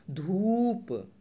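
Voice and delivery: धूप very slowly, the ऊ pushed outward and held; long ऊ thrown out. Shown as a demonstration. Teacher showing how to push out the ऊ in धूप. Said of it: "बाहर की तरफ फेंकते हुए पढ़ेंगे".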